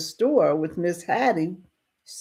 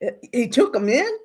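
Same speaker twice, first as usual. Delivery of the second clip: higher-pitched nervous questioning; acted nervousness; 9 semitones higher than usual. Townsman questions a boy about the sick settlers, acted nervousness. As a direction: question it in mock disbelief, rising at the end. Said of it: "Mister Bass is getting nervous".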